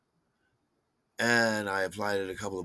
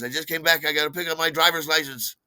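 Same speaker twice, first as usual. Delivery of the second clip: high, hurried, flustered excuse; mock frantic; 6 semitones higher, 4 dB louder, faster than usual. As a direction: imitate a panicky friend rushing off. Acting out friend's frantic panic.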